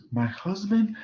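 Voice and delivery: high-pitched incredulous question; acted disbelief, pitch leaping up. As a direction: ask it as if you can't believe it. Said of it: "in a state of disbelief".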